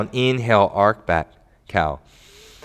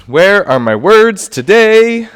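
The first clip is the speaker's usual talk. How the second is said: self-mocking; 8 semitones higher, 9 dB louder than usual. Real, not acted. Laughing at own slip.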